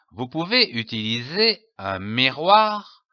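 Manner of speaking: normal talking voice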